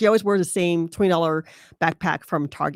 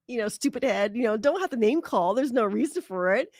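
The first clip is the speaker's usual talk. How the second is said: ordinary delivery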